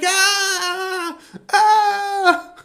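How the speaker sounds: high, loud hammed-up shrieks; mock scream. Imitating a horror-movie lady fleeing toads, hammed-up screaming.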